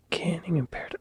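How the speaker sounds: hushed search, barely voiced; breathy under-the-breath muttering; whisper. Real, not acted. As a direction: whisper to yourself while hunting the map. Searching map quietly.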